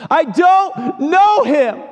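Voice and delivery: very high, slow, anguished cry; preacher re-enacting Peter's denial at full pitch; straining denial. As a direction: wail the denial. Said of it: "denies him three times".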